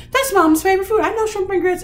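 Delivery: a fourth grader who knows her mom's favorite food; daughter's proud answer. Retold or imitated. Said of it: "She's like".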